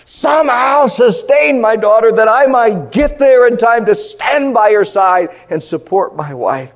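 loud, high and slow pleading; anguished prayer. Retold or imitated. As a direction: plead slowly for a dying daughter. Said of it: "Crying and sobbing".

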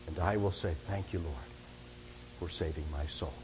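soft, low, intimate invitation; tender appeal. Acted. Preacher inviting listeners to trust Jesus, soft and tender. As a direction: lean in and plead gently.

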